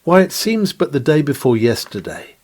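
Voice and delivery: ordinary talk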